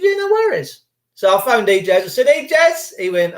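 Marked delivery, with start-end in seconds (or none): 0.0-0.8 breezy. Retold or imitated. Breezy reply to mother. 2.3-2.9 mock cheery greeting. Sing-song greeting to bailiff.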